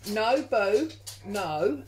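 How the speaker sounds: loud, sharp telling-off; calling out to dog. Real, not acted. Owner to her dog who leapt up, loud scolding. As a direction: raise your voice to check the dog.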